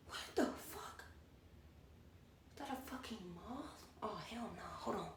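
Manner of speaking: whispered, alarmed disbelief; real startled whisper. Real, not acted. Vlogger spotting a moth mid-story, startled whisper. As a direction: whisper it under your breath, thrown off. Spots a moth while recording.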